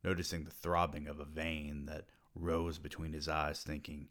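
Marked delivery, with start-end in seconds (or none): none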